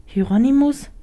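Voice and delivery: hesitant. Acted. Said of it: "fragte sie zögerlich".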